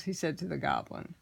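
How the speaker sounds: usual speaking voice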